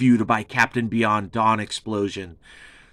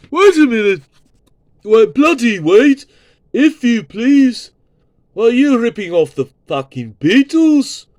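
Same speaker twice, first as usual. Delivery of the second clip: much higher and louder mock British rocker, indignant; 12 semitones higher, 8 dB louder than usual. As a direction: ham up an outraged British musician accusing a rip-off. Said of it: "[name] would be like".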